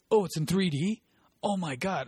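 loud, high-pitched thrilled surprise; excited kid. Retold or imitated. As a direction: imitate a teenager suddenly thrilled. Acts out kid thrilled about 3D.

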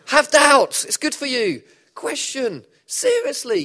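laughing, high and loud; excited high urging. Real, not acted. Preacher urging his congregation to doubt, excited and high. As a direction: push them to doubt, grinning.

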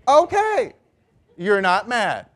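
high-pitched, loud mock agreement; placating disbelief. Onlooker answering someone who denies being mad, placating disbelief. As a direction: humour them without believing a word. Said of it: "You're like".

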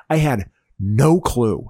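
ordinary talk, nothing marked

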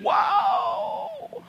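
long, high Southern holler; Southern whoa. Acted. Preacher voicing Isaiah's sudden realization, hammed-up Southern cry. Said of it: "not sure if he said it that Southern".

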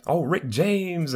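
high, slow drawn-out catchphrase; fan catchphrase. Retold or imitated. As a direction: sing it out like a fan. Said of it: "people would say".

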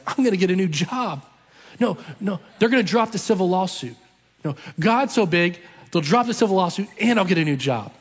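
eager, emphatic, mildly hammed-up hopes; imitated excitement. Dad reacting to the promised miracle, imitated excitement.

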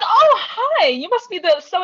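high, loud, over-eager hello; hammed-up networking enthusiasm; mock gushing greeting. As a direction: pounce on them with exaggerated delight. Acting out buttonholing the professor.

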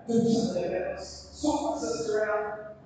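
mock furious outburst; acted fury. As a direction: demand it in exasperation. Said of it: "furious and angry".

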